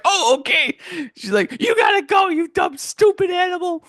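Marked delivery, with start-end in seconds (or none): 0.0-0.8 high, loud imitation of her; mimicked reaction. Acts out the woman's reply. 1.6-3.8 very high, loud imitation of scolding; hammed-up shrieky telling-off; mock scolding. Acts out woman scolding the snail.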